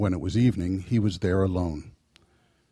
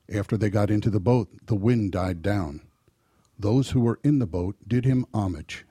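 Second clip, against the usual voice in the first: ordinary talk, nothing marked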